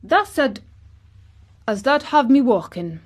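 put-on high, proud boy in broad Yorkshire; triumphant put-on Yorkshire dialect; exultant Yorkshire. A narrator's reading. Colin boasts to Dickon, exultant in best Yorkshire. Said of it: "spoke exultantly to Dickon in his best Yorkshire".

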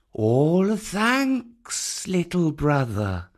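a hint of indulgence; amused python. A narrator's reading. Kaa speaking.

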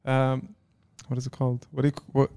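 ordinary delivery

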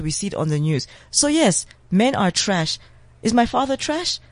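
normal talking voice

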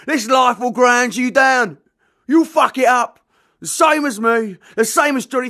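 loud, high, bullying yell. Acted. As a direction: tower over the boy and bellow. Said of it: "Keith was yelling".

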